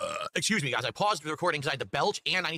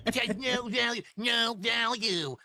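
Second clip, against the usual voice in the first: slow, much higher mocking voice; mocking imitation; 9 semitones higher, much slower than usual. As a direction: stretch out the words, mocking him. Repeats friend's words mockingly.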